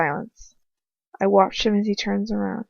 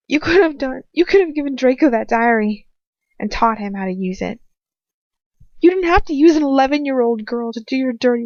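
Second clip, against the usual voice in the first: loud, furious, higher accusing; angry accusation; 3 semitones higher, 6 dB louder than usual. A narrator's reading. Captive girl berates the coward in loud anger. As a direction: tear into him, voice raised. Heroine berates her captor.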